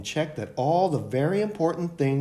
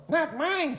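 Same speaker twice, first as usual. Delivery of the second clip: put-on much higher child's voice; second child; 14 semitones higher than usual. A narrator's reading. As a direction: chime in like a little kid. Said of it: "said another".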